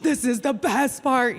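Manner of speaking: loud, eager insistence; excitedly grab mom's attention. Retold or imitated. Daughter giving her mom a tour, so excited. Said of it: "I'm, like, so excited".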